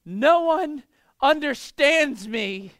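put-on slow, loud, high wail of a dramatic seven-year-old; mock sobbing child. Daughter at the playground wailing to her parents. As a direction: ham up a child's tragic cry. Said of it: "And she goes".

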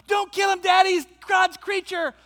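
put-on high, loud child begging him to stop; pleading girl. Daughter begging dad not to kill the snake, high pleading shout. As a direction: cry out like a kid saving her pet.

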